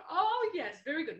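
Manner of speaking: ordinary delivery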